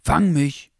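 high, loud playful shout; cheeky puppy call. A narrator's reading. Cheeky puppy daring the others to chase, calling out. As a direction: call out like a cheeky little dog. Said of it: "rief ein sehr vorwitziger kleiner Hund".

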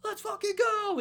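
high, full-out rallying yell; acted battle cry. Crew taking off towards the building, high battle cry. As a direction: yell it like a charge is starting.

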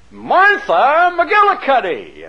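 high, drawn-out announcer call; game show announcer. Retold or imitated. Announcer calling Martha from the audience, game show shout. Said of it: "when this announcer".

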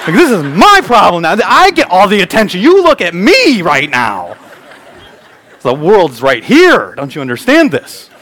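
high, loud, drawn-out mock tantrum; hammed-up toddler demanding all the attention; indignant toddler. Two-year-old claiming the attention in the car, indignant toddler. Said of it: "then Janie says".

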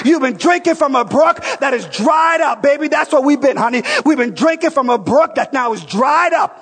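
fiery revival preaching; preaching at full force. Acted. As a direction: preach it out to the room at the top of the voice. Full-force preaching about the brook.

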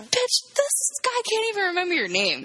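high-pitched mock telling-off; incredulous scolding. Viewer to movie woman, incredulous scolding. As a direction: berate a foolish movie character.

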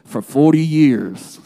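lightly attempted Southern accent, loud and low. Preacher quoting Red's movie narration, attempted Southern accent. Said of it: "he has a southern accent".

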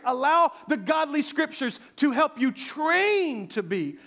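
high, loud building sermon climax; preaching at full force. Acted. Pastor urges congregation to train, preaching at full force. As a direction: drive it home to the congregation.

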